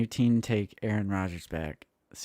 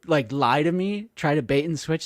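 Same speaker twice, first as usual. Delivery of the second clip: higher, louder mocking voice of an astrology believer; mocking astrology fan; 5 semitones higher, 5 dB louder than usual. Mocking an astrology fan to the co-host, higher and louder. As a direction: ham up the smug astrology fan. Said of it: "and then they're like".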